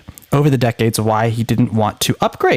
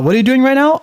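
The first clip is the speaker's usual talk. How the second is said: high, rising mock question; teasing high-pitched question to the chatbot; mock user question; 11 semitones higher, 3 dB louder, faster than usual. User retells asking the chatbot again, high and teasing. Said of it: "I would say".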